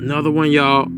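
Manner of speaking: ordinary talk, nothing marked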